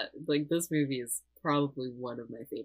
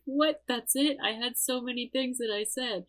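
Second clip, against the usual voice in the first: high-pitched, incredulous protest; mock indignation; 9 semitones higher than usual. Man on the treadmill protesting his cut interview, indignant. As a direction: act out a higher-pitched, put-out disbelief.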